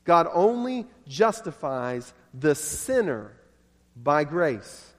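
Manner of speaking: God and by slowly leaned on; slow, weigh only and by grace; stress on only and by grace. Shown as a demonstration. Preacher repeating the line, slow stress on by grace. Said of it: "You notice where I put the emphasis on that".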